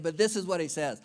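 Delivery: ordinary talk, nothing marked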